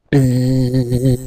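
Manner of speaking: a low buzzing bee noise. Acted. Storyteller buzzing like a bee. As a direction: buzz like a bee.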